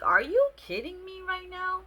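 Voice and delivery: high, loud incredulous outburst; outraged disbelief. Retold or imitated. Outraged at the date's joke, high and loud. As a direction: throw it back in disbelief.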